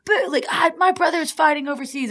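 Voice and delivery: high, loud, indignant heckler; mad heckler woman. Retold or imitated. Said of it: "like, so mad".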